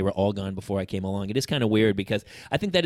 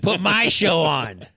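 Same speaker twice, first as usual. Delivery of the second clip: put-on louder, high, slow child's demand; 6 semitones higher, 5 dB louder, much slower than usual. Dad imitating his daughter demanding her TV show. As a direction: insist like a little girl wanting her show. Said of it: "Hannah will always be".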